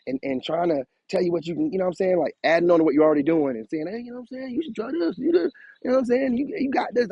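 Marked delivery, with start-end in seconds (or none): 3.9-7.1 higher-pitched hype-man encouragement. Acted. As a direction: lightly act out hyping up a friend. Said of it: "I hype him up every time".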